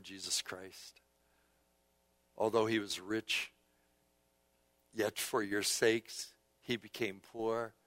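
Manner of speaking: low, soft, reverent delivery; subdued pulpit meditation; hushed scripture reading. Real, not acted. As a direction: read it quietly, letting phrases sit between pauses.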